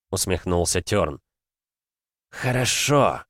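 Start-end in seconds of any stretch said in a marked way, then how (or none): 2.3-3.2 stagey menacing whisper meant to be heard; hammed-up ominous whisper; theatrical sinister whisper. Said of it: "театрально зловещим шёпотом".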